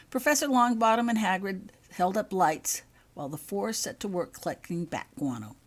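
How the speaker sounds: usual speaking voice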